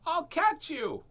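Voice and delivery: put-on high little boy promising; imitating a young son; child voice. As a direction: reassure like a small kid. Son's voice retold.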